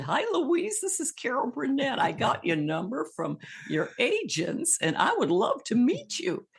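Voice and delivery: high, singsong comedienne on the phone; Carol Burnett. Retold or imitated. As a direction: imitate the star's greeting, pitch leaping way up. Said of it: "and she said".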